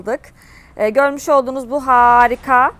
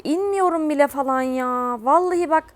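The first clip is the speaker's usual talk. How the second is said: usual speaking voice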